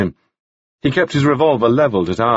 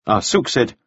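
usual speaking voice

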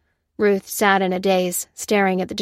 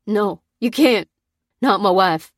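anguished, louder plea; grief-stricken begging; 5 dB louder than usual. A narrator's reading. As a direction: plead against losing his wife. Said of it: "begged Pete".

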